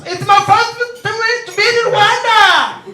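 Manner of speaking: high, loud, slow put-on client; hammed-up foreign client; mock client voice. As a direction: spell it out like a visiting big shot.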